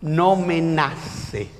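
drawn-out Mendoza phrasing; Mendoza accent. Retold or imitated. Preacher quotes a youth refusing baptism, slow Mendoza drawl. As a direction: stretch it slowly like someone from Mendoza. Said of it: "como dice el mendocino".

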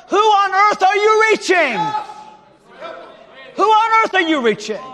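high, loud preaching demand; shouted challenge. Acted. Pastor challenging the congregation, shouted high. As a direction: throw the question at them full force.

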